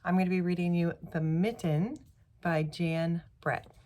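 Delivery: plain reading voice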